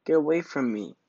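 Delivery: loud, high snap to go away; a proud fish yelling at a pest; angry cry. Acted. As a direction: shout him off angrily. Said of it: "cried Rainbow Fish".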